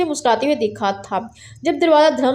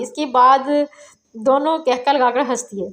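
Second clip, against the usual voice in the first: ordinary delivery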